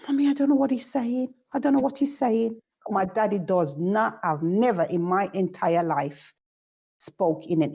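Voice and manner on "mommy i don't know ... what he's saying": put-on quick, higher, whiny child's complaint; imitating her daughter. Daughter on the phone complaining to mommy, fast and high. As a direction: do a thirteen-year-old who can't follow granddad. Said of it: "she will go".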